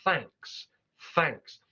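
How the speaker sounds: thanks with a crisp TH; clear TH. Shown as a demonstration. Teacher showing received pronunciation thanks. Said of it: "with that T H sound being very well pronounced".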